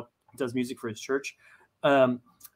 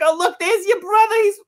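put-on mom in a much higher, louder New York accent; 7 dB louder than usual. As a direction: point out the son on stage in a New York accent. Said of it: "why the fuck I gave her a New York accent".

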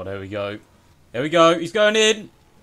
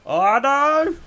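shocked cry; 12 semitones higher than usual. Real, not acted. Crash in racing game.

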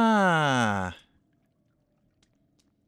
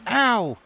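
pained yelp; 9 semitones higher, 4 dB louder than usual. Real, not acted. Hit by enemy.

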